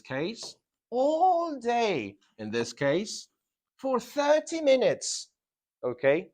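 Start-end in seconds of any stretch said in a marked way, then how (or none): 0.9-2.2 ALL held long on a high pitch; emphasis on duration. Shown as a demonstration. Teacher pointing out the time reference, duration emphasized. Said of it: "that duration normally is indicated". 3.8-5.3 THIRTY MINUTES slow and very high; emphasis on duration. Shown as a demonstration. Teacher pointing out the time reference, duration emphasized. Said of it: "that duration normally is indicated".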